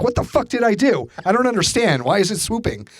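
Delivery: high, frantic disbelief at the bird; panicked yelling. Retold or imitated. Ducking from a swooping bird, high panicked yelling.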